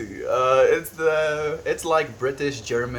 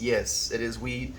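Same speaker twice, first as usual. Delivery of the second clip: ordinary talk, nothing marked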